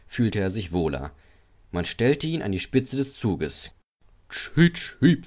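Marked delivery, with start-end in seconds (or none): 4.3-5.3 high imitated train toot; engine whistle. A narrator's reading. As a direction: peep like a small engine's whistle. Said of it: "pfiff Edward". (hohes Pfeifen einer Lok)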